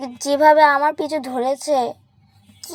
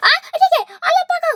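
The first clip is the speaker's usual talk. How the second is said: high frightened cry of surprise; shrieking in sudden fear when the haunted doll appears; startled fright. Real, not acted. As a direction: jump at the sight of the doll.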